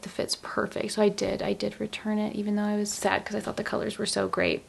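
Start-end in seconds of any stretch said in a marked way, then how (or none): 0.0-0.9 whisper. Retold or imitated. Whispered aside about knitwear.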